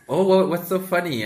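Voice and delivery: high, lilting what's so funny; curious high question. Retold or imitated. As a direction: ask brightly and high like an outsider. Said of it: "they come and they're like".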